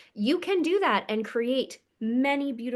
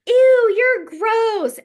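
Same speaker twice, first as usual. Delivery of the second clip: much higher, loud, drawn-out mocking "ew"; disgusted girl; 11 semitones higher, 13 dB louder, much slower than usual. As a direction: sneer it like a mean schoolgirl, stretched slow. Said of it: "this girl looked at me and goes".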